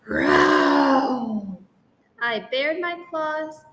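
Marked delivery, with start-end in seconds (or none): 0.0-1.6 low, loud growled word; ferocious growl. Acted. Student threatening the dog, low loud growl. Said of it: "I let out a ferocious".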